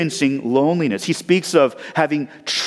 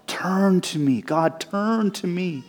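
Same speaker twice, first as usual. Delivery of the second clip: quiet plea; 4 semitones higher, 5 dB quieter, much slower than usual. Retold or imitated. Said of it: "which is why he cries out".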